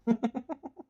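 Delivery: high, sneering cackle; gloating high laugh; mocking villain laugh. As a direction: laugh at her helplessness. Villain laughs at her plea.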